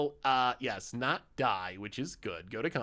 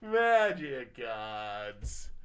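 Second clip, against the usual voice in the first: slow, drawn-out lament; much slower than usual. Real, not acted.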